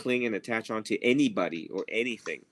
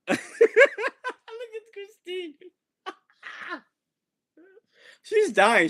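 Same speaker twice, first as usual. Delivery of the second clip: ordinary talk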